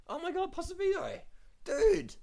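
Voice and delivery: exasperated cry. Real, not acted. Despairing cry at the rider.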